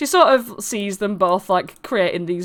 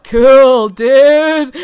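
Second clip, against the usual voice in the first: louder, laid-back approval; loud cool dude; 5 semitones higher, 10 dB louder than usual. Acted. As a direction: shout it like an impressed surfer. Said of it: "Northwind's like".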